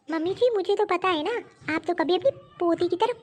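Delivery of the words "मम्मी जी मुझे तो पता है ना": ordinary talk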